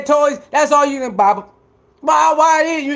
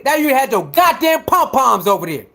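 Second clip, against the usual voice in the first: normal talking voice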